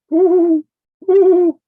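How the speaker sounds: high, loud falsetto hoots; two round owl "woo"s. Shown as a demonstration. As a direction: hoot like an owl. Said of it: "We got wise owl in the house".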